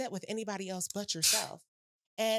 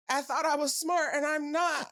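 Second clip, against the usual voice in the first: raised, comically dismayed admission; mock self-berating; 4 semitones higher, 3 dB louder than usual. Entrepreneur confessing a moment of self-doubt, mock self-berating. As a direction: play up the letdown in yourself.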